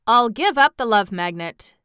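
keen and emphatic; eager. A narrator's reading. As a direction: offer it up eagerly. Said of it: "said the Shaggy Man eagerly".

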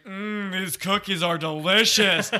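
slow, savoring mock movie-neighbor voice; Phil Hartman as Ted. Host imitating Ted eating cookies, slow savoring voice. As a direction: draw it out with exaggerated relish. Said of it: "That's not really Phil Hartman".

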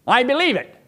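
high, loud pleading voice; mimicked wife's plea. Quoting his wife to the congregation, high and pleading. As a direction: act out her insistent high cry.

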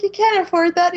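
high, laughing protest; laughing retort. Retold or imitated. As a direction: squeal it through laughter. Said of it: "And then she's like".

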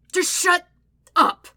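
loud, high burst of exasperation; shouted, frustrated retort; angry shout. A narrator's reading. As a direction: yell it, breaking before the last word. Brother yells at taunting sister.

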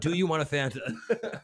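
mock server. Mock server offering Fanta.

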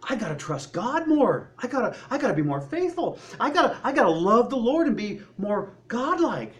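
a hint of a self-righteous believer; mock pious vow. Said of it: "talking and complaining about".

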